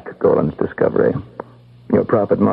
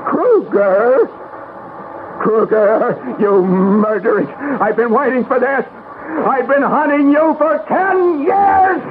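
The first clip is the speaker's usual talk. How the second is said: much higher, rising from stunned disbelief to a furious cry; the hunted face found at last, let it explode; from stunned whisper-thin questions to a raging, slowed accusation; shocked then enraged. Acted. Recognizes his wife's killer.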